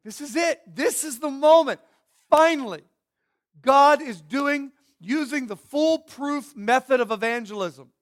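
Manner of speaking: loud, high, triumphant proclamation; mock excitement. Preacher voices onlookers expecting foolproof evangelism, mock excitement. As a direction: imitate someone thrilled that the big moment has come. Voicing listeners thrilled at God's voice.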